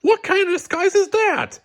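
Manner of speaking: very high incredulous squawk; can't believe what he's seeing; exasperated disbelief. Acted. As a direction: Bob staring at a chicken costume, high and stunned. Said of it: "exclaimed Bob".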